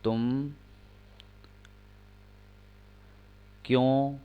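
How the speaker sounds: slow, with long gaps between words; slow dictation pace; dictating word by word. Shown as a demonstration. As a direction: dictate for students to write. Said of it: "एक sentence और लिखें".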